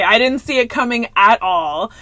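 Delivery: ordinary delivery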